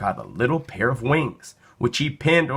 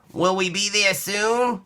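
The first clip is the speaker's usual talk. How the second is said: put-on child voice; 9 semitones higher than usual. Child asks impatiently.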